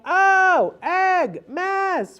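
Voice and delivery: high, loud, separated exclamations; exaggerated delight for a child; playful exclaiming. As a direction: make each word a big fun event. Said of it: "you wanna make it fun".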